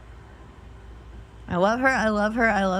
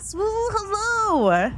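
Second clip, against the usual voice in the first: choking on water; 13 semitones higher, much slower than usual. Real, not acted. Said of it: "I'm choking on water".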